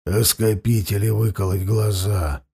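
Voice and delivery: low, rasping croak; hoarse wheeze. Acted. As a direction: force the words out hoarsely through a dry throat. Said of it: "прохрипел он".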